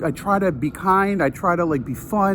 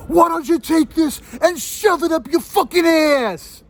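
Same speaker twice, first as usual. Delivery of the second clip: high, slow, furious re-enacted yell; losing it; 12 semitones higher than usual. Angry concertgoer tells off the man who filmed, re-enacted outburst. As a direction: explode at him, drawing out every word. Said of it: "I just lost it".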